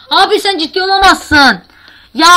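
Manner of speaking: loud, high cry of disbelief; outraged disbelief. Real, not acted. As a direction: shout it like you can't believe the game. Oyunda düşüp isyan ediyor. (inanamayan isyanlı bağırış)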